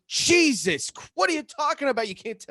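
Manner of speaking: high-pitched retold exasperation; exasperated outburst. Brother to sister who won't tell, high exasperated outburst. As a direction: burst out in mock disbelief.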